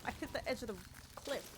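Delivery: normal talking voice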